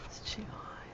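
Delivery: soft whisper while concentrating. Real, not acted. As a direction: whisper it under your breath. Concentrating on fine painting.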